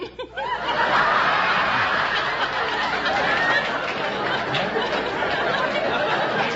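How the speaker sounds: long, high, breathy laughing fit. Real, not acted. Laughing hard at her own joke to the host. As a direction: let the laugh run on.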